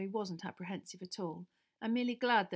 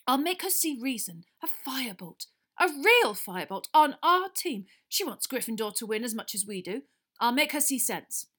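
louder, higher zeal; fervent Wood; 4 semitones higher, 9 dB louder than usual. A narrator's reading. Wood vowing to win McGonagall over, fervent. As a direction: swear it with fervour.

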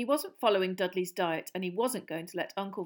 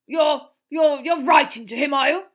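loud, stammering fake calm; 7 semitones higher, 16 dB louder than usual. A narrator's reading. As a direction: force calm over panic. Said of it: "in a would-be calm voice".

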